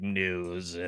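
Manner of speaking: low, soft flat robot voice; robotic voice. Retold or imitated. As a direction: imitate a robot saying news. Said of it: "Oh, you sound so robotic".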